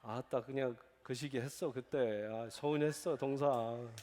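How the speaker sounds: low, soft Jeolla dialect complaint. Retold or imitated. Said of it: "전라도 뭐라고 그러겠어요?". (전라도 사투리로 낮고 부드럽게 서운함 토로)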